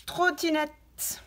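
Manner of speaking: «trottinette» drawn out slowly; slow articulation. Shown as a demonstration. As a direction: stretch «trottinette» syllable by syllable. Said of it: "il faut dire le mot et il faut l'écouter". («trottinette» très lentement)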